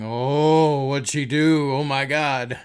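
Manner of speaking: loud, gasping mock horror; mock shock. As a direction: gasp it like a scandalised audience. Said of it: "are sitting there going".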